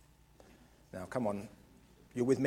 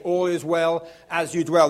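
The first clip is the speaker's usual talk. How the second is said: proclaimed slogan; 7 dB louder than usual. Shown as a demonstration. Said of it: "you're gonna say".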